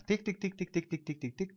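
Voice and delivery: quick-fire run of soft ticks; evenly repeated mock clock ticks; imitated timer ticking. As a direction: tick along like a countdown clock. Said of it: "empieza a sonar".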